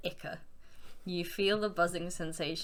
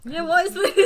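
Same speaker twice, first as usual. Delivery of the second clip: surprised; 12 semitones higher, 12 dB louder than usual. Real, not acted. Reacting to silly image.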